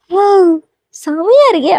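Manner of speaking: high, admiring exclamation; impressed wow. Acted. Admiring the new modern look, pleasantly impressed. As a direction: let out an approving wow.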